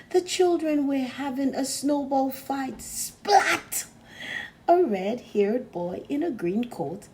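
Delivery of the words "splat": loud, high splat effect; splat sound. A narrator's reading. Narrator voicing a snowball smacking the engine, loud splat. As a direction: punch the splat like a snowball hitting.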